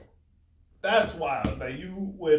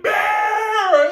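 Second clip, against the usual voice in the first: calls a dog's name in a high, loud cry; imitating a yell; 8 dB louder than usual. Grieving owner calling out for the dog, high loud cry. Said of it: "their owner just yelled out, cried like".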